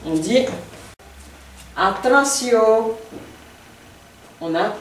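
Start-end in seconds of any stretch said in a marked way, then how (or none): 1.8-2.9 ATTENTION slow and higher, held at the end; slow, raised, sustained ATTENTION; stressed middle, rising. Shown as a demonstration. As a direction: draw out ATTENTION, lifting the pitch after the first syllable. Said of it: "l'accent tonique qui est toujours maintenu sur le milieu".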